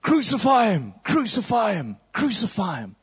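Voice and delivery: high crowd yell that fades; crowd shouting. Acted. Mob answering Pilate, a high shout fading out. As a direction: shout it like a mob demanding death. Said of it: "he points to Jesus and says".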